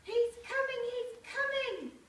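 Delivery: high, loud panicked cry, slowing on the second. Acted. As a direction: villager warning everyone that the monster is coming, panicked. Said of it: "cried one of the villagers".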